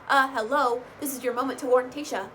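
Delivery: a mock scold at the screen; exasperated call-out. Real, not acted. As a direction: call her out, hello. Scolding the cast member.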